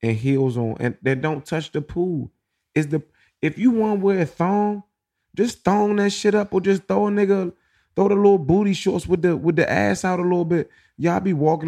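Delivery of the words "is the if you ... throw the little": worked-up. Real, not acted. Mocking advice, pitched up.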